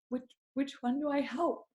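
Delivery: hushed, high, anxious self-quote; overwhelmed. Retold or imitated. Overwhelmed mom fretting to herself, hushed and high. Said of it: "you're like".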